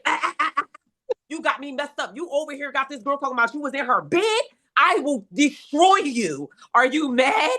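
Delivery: mock him, pitch up and pointed; mock angry confrontation. She confronts the cheating man.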